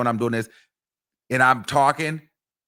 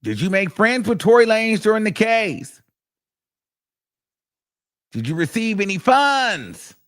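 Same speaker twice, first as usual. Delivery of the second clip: battling lawyer; 8 semitones higher than usual. Retold or imitated. Lawyer battling the witness in cross-examination, high-pitched combative questioning. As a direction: imitate a lawyer pressing the witness. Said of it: "he had came back and battled".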